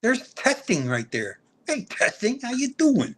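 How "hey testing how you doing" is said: higher-pitched Jersey greeting; Jersey accent. Shown as a demonstration. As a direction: greet them with Jersey swagger. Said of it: "that's a Jersey thing".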